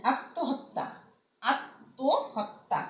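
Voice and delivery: আত্মহত্যা drawn out slowly, m silent, doubled t; আত্মহত্যা as attohotta, stretched out; slow careful pronunciation. Shown as a demonstration. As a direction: spell out আত্মহত্যা sound by sound, very slowly. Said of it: "মটা উচ্চারণ হচ্ছে না".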